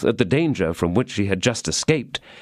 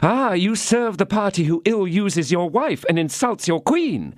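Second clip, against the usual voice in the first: raised, reproachful outburst; wife crying out at her husband; indignant cry; 11 semitones higher than usual. A narrator's reading. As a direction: accuse him of betrayal. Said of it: "cried she".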